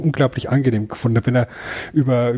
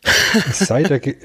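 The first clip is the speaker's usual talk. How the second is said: laughing; 3 semitones higher than usual. Real, not acted. Repeats line, bursts out laughing.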